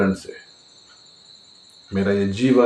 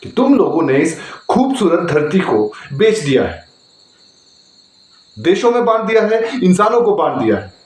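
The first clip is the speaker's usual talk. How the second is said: raised, higher-pitched stage denunciation; indignant accusation; 7 semitones higher than usual. Acted. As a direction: accuse all mankind with rising indignation. Prisoner condemns mankind in monologue. (आक्रोश भरा आरोप)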